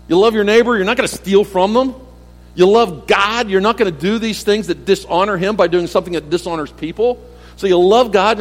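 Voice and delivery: fiery pulpit delivery; preaching at full force. Acted. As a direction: drive each point home to the congregation.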